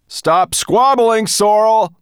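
slow, high, loud rebuke; called back. A narrator's reading. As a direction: call it back firmly, drawn out. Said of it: "Firedrake called back to her".

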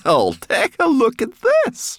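high, loud, drawn-out crow; triumphant cry. A narrator's reading. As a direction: cry out like a brownie showing off her catch. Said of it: "she cried".

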